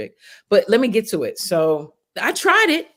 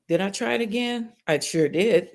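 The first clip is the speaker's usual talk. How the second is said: normal talking voice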